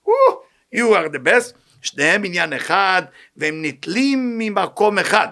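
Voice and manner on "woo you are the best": louder, slowed hammed-up "woo"; mock clown cheer. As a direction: ham up a crowd-pleaser's cheer. Mocking a clown seeking applause.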